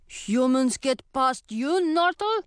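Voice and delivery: slow troll, rising high at the end. Acted. Troll asking the troll behind him, slow troll voice. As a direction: question him like a lumbering troll.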